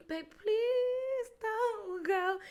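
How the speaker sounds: high, slow whiny begging; drawn-out mock plea to stay; mock crying plea. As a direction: imitate her pleading ex, a touch weepy. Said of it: "outside my window crying".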